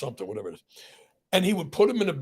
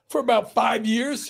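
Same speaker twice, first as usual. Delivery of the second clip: laughing; 10 semitones higher, slower than usual. Retold or imitated. Father quotes the other squirrel man.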